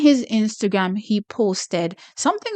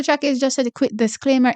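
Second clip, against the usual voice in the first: ordinary delivery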